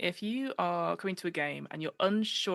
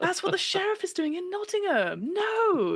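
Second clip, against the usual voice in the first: mock indignant knight; 13 semitones higher, 4 dB louder, slower than usual. Acted knight scene reply.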